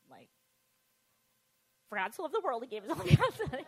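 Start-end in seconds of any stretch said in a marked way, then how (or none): 1.9-3.6 louder, declaimed, building to the end; child proudly reciting to her aunt, loud; proud loud recital. Retold or imitated. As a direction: belt the verse out proudly. Reciting verse again to aunt.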